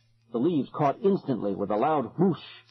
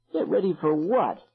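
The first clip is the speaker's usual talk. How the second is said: demanding; 6 semitones higher than usual. A narrator's reading. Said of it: "he demanded".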